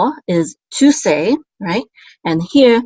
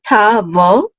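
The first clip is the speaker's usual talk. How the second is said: slow "ta vo", syllable by syllable; sounding out syllables; 3 semitones higher, 5 dB louder than usual. Shown as a demonstration. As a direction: demonstrate "ta vo" slowly for learners.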